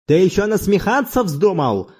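indignant outburst, pitched high; restrained roar. A narrator's reading. Manolakas stung by mockery, a restrained roar. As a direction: flare up at the mockery without full volume. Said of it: "взревел Монолакас".

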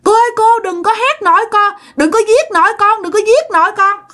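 high, imitated child's screams; child crying out. Child heard from the bed begging for grandma, screaming. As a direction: plead in a shrill-pitched child's yell. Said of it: "nghe nó la làng".

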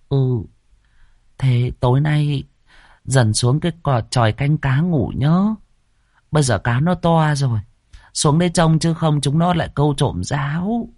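high, coaxing mistress voice; giọng bà ba. Acted. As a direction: sweet-talk the servant into it. (giọng bà chủ cao, ngọt ngào dỗ dành)

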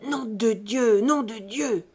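loud, angry swearing; furious oath, hurled out; cursing. A narrator's reading. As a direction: curse forcefully as you storm out. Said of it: "en jurant".

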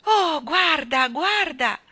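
high, loud cry of delight; joyful shout. A narrator's reading. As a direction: cry out for joy, drawing out the first look. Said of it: "gridò Jane, saltando dalla gioia". (grido di gioia)

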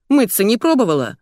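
acted snippy rebuke; rude jibe. A narrator's reading. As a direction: needle a stranger with contempt. Rude stranger insults the narrator.

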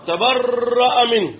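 "تبرأ منه" drawn out slowly and evenly; measured slow delivery of "تبرأ منه"; slow statement. Shown as a demonstration. Repeating the verdict for emphasis.

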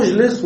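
أجلس begun with u, "ujlis"; wrong damma on the alif. Shown as a demonstration. Teacher ruling out a form, أجلس begun with u. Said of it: "لا نقول".

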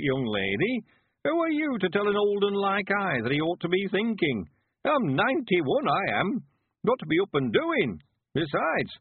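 put-on high, emphatic rustic old-timer; lively, high old man's dialect voice; old country man, spirited and defiant. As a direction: brush off doubts as a feisty old villager. Narrator plays old Mister Weevil.

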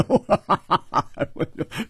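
big open-throated guffaw; roaring laugh of a carefree hero; hearty laugh. Acted. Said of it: "胡铁花大笑".

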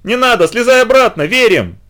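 high, loud panicked plea; визг. A narrator's reading. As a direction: squeal in alarm, like a frightened girl. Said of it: "завизжала и затопала вдруг внизу ногами Литка". (визгливо, в панике)

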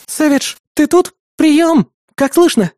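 high, loud radio call; calling over radio. A narrator's reading. Partner hailing Savage over the radio, calling out.

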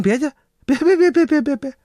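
rapid high stammer of no no no; flustered refusal. Acted. Old innkeeper refusing the monsters' payment, flustered high stammer. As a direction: hurriedly wave off the money.

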